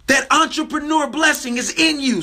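usual speaking voice

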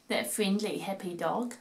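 ordinary delivery, nothing marked

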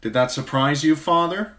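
sharp, bitter son's challenge; Tyrion's voice. A narrator's reading. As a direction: needle him with wry bitterness. Tyrion voiced challenging his father.

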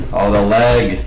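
usual speaking voice

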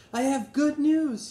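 high, loud and slow, a grand announcement; announcing good news. Acted. Grandfather bursts in and announces his news, loud and high. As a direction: proclaim it to the whole room. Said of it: "he announced".

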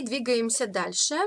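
normal talking voice

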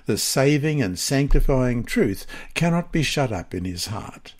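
ordinary talk, nothing marked

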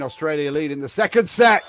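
loud and high, rising on better; excited shout. Real, not acted. Commentator hailing the Australian lead, loud and rising. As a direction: cheer it to the crowd. Australia ahead in second set.

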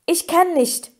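a touch of breathless struggle; gasping protest. A narrator's reading. Harry struggles in the water for his broom, gasping protest. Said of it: "keuchte Harry".

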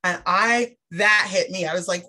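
ordinary talk

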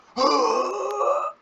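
loud high rasping gasp; imitated dragon death cry. Shown as a demonstration. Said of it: "dragon's death sound is actually very similar".

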